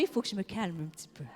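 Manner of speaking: dropped low and quick to herself; hushed self-calming aside. Real, not acted. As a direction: rein yourself in, softly and fast. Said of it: "quand je suis enflammée".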